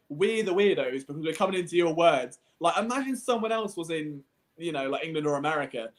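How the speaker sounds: ordinary delivery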